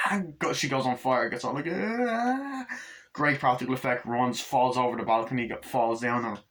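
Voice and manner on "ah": high, drawn-out mock scream; retold scream. Burning woman screams in retold scene.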